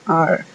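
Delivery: are said as "ar", no E sound; are with a silent final E; English word are. Shown as a demonstration. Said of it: "se pronuncia are". ("are" pronunciada "ar", sin sonido de E)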